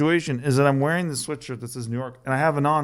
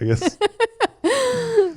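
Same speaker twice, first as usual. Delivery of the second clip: laughing. Real, not acted. Laughs at his own failure.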